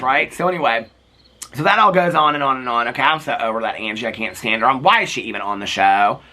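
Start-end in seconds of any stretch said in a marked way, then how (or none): none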